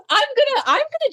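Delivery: high giggling start; laughing. Real, not acted. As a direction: laugh through the words. Laughs before gently teasing.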